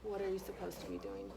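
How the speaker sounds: quiet correction. Real, not acted. Teacher quietly correcting child.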